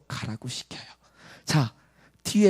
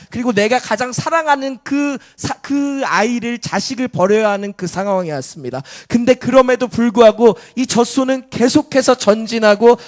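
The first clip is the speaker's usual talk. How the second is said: loud, raised, driving sermon voice; full-force preaching; 5 semitones higher, 9 dB louder than usual. Real, not acted. Preacher urging congregation about the cow pressing on, full-force preaching. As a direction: drive each phrase home with urgent volume.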